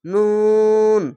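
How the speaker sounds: নুন with a very long, level oo; chant-like, drawn-out নুন; three-alif stretch. Shown as a demonstration. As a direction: hold নুন on one note. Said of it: "তিন আলিফ টেনে পড়বেন".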